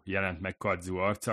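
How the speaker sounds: ordinary delivery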